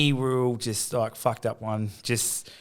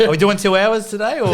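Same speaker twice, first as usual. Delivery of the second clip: laughing; 8 semitones higher, 6 dB louder than usual. Real, not acted. Teasing host, laughing.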